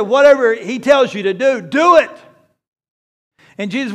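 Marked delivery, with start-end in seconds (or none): 0.0-2.1 firm command. Acted. Preacher repeats Mary's command to the servants, louder. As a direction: press the command home loudly. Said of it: "And he said".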